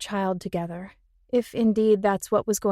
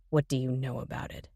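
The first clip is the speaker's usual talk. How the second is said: growled; 8 semitones lower, 8 dB quieter than usual. Acted. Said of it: "growled Ben".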